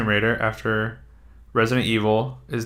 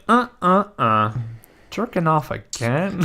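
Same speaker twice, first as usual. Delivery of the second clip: mock plant reaction; 6 semitones higher than usual.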